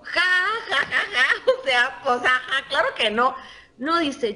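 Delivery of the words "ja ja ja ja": spoken ha ha laugh. Real, not acted. Laughs at own joke.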